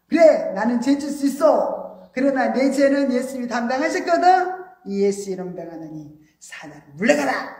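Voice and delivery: loud, high, bold rebuke of Satan; bold declaration. Acted. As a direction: command the devil to leave, strongly. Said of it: "담대하게, 강하게 말해야 돼요".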